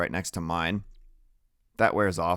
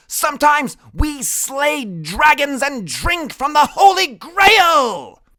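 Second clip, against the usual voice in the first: much higher, loud slow proclamation building to a shout; proclaiming; 14 semitones higher, 7 dB louder, slower than usual. A narrator's reading. Freak on Max's shoulders boasting to the class, high loud shout. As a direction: announce it heroically, louder and louder.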